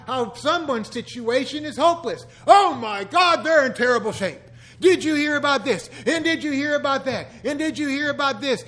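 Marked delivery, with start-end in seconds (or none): 2.5-8.6 loud, high, mock-alarmed busybody; hammed-up gossip. Church member relishing someone's hopeless situation, hammed-up gossiping. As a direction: pile up the dreadful news in mock horror. Acts out congregation gossiping over bad news.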